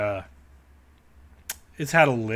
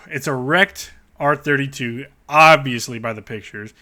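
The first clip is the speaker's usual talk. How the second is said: ordinary talk, nothing marked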